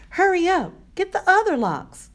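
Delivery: high, insistent urging; urgent command. A narrator's reading. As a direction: hurry him along, pitched right up. Urging him to open locks.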